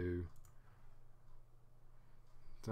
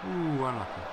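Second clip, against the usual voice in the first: loud, high "ooh" falling into "unlucky"; real cry of sympathy for a near miss; near-miss reaction; 4 semitones higher, 8 dB louder than usual. Real, not acted.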